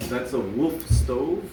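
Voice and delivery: ordinary delivery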